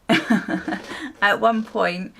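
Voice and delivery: words broken by laughter; laughing through it. Real, not acted. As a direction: giggle through the phrase.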